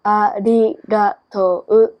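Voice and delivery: slow, drawn-out ありがとう. Shown as a demonstration. Japanese speaker showing the learner how it sounds, slow. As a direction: stretch out each syllable of ありがとう. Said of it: "but say it slow".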